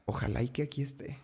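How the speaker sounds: preocupación en voz muy baja; murmured worry, as if thinking to himself; niño aprensivo. Retold or imitated. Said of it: "era muy aprensivo". (aprensivo, en voz bajita)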